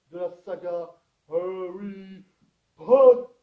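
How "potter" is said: shouted title. Acted. Loud announcement of the saga title.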